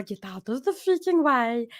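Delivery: sassy dog voice; Pepper. Shown as a demonstration. Sassy dog making comments to owners, diva voice. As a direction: order people about as a diva pet. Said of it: "she's really sassy".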